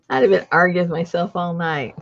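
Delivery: usual speaking voice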